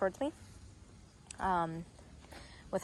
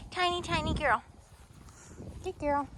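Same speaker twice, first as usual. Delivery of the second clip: higher cooing praise; 7 semitones higher than usual. Real, not acted. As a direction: fuss over the tiny puppy. Cooing at tiny puppy.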